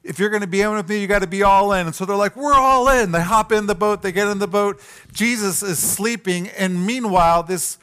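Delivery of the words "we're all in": disciples shouting their eager yes, much higher and louder; eager all in. Retold or imitated. Disciples answering Jesus with an eager, loud all in. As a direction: cheer it like new recruits who are all in.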